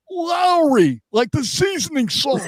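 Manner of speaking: high drawn-out name; high goofy call; mock high voice. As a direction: squeak out the name. Terradome quote, mock voice.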